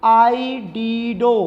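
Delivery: आइडिडो very slowly, syllable by syllable; pronunciation demo. Shown as a demonstration. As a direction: stretch out आइडिडो to a long final 'doh'. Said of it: "ये क्या बन जाएगा?". (आइडिडो का खींचकर धीमा उच्चारण)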